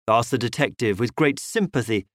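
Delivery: usual speaking voice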